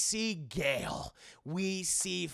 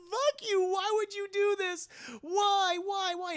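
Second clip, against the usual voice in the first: high, loud, tearful mock anguish; 14 semitones higher, 4 dB louder than usual. Sydney screaming at the killer, high tearful anguish. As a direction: cry out why in hammed-up grief. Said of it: "She's like".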